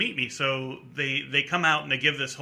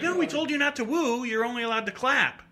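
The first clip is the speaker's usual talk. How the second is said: stern scolding; 10 semitones higher than usual. Retold or imitated. Security scolding rules.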